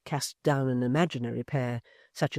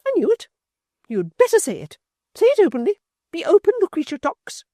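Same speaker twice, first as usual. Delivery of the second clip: high, loud, stern demand; fierce high sternness; desperate sternness; 11 dB louder than usual. A narrator's reading. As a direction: order her to confess. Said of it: "with desperate sternness".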